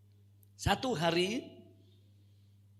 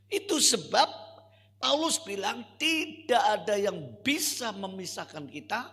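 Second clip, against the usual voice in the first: forceful preaching; 5 semitones higher, 10 dB louder than usual. Acted.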